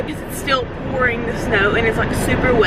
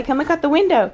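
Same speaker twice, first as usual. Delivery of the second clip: normal talking voice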